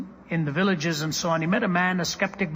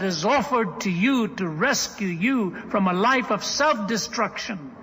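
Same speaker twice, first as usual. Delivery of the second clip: high, loud sermon climax; impassioned preaching; 6 semitones higher, 4 dB louder than usual. Real, not acted. Preacher proclaiming the woman's new worth, impassioned preaching. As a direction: preach it out with ringing, emphatic force.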